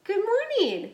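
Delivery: high, bright-toned sing-song greeting; sing-song good morning. Real, not acted. Wife teasing her sleepy husband awake, high sing-song. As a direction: coax him awake with a lilting hello.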